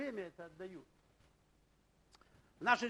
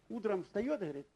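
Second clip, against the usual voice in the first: ordinary talk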